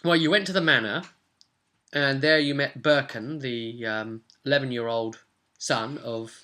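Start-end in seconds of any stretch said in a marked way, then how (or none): none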